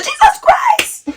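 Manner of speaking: very high, drawn-out mock shriek; dramatic mother. Mom sees her daughter's swollen mouth, dramatic mock shriek. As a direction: imitate an over-dramatic Jamaican mom's shock. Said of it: "Jamaican people are dramatic".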